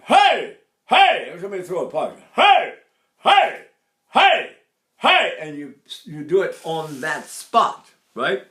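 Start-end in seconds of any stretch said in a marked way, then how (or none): 0.0-1.4 high, loud barked hey; karate shout. Shown as a demonstration. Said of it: "you shout in that spot". 2.4-5.3 high, loud barked hey; karate shout. Shown as a demonstration. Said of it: "you shout in that spot".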